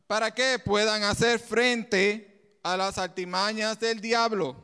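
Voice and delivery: "Para que puedan hacer frente a las artimañas del diablo" said slowly, word by word; slow repeat. Shown as a demonstration. Preacher repeating the verse slowly for the congregation. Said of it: "Lo volvemos a repetir".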